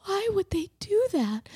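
put-on whispery, high little-girl voice; tiny, hushed imitation of a confused kid; confused child. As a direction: whisper it like a bewildered small child. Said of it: "She was so confused".

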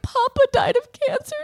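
put-on high, small child voice; upset child. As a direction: imitate a stricken little girl. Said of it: "she was like".

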